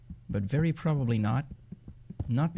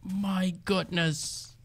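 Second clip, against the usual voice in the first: high, drawn-out gasp of wonder; slow, breath-taken amazement; awestruck; 6 semitones higher, 4 dB quieter, much slower than usual. Real, not acted. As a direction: stretch the words out, high and fading softer. Awestruck by the view.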